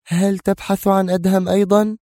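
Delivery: high-pitched woman's disbelieving query; indignant question. Acted. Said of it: "سألته جيهان مستنكرة". (باستنكار)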